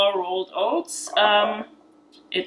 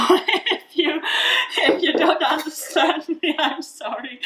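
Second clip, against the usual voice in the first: laughing apology; 7 semitones higher than usual. Real, not acted. Apologising for her accent.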